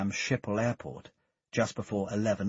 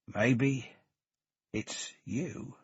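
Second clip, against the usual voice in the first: drawn out; 3 semitones higher, 3 dB quieter, much slower than usual. A narrator's reading. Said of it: "Reed said, drawing out the words".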